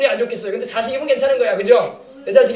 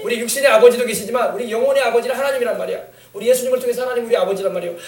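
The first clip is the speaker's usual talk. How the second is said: ordinary talk, nothing marked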